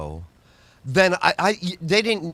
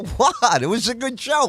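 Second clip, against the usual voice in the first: mock indignant protest; 7 semitones higher than usual. His own protest retold.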